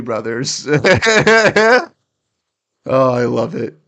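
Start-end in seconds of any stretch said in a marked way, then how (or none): 0.7-1.9 high loud burst of laughter. Real, not acted. Laughs at the tweet.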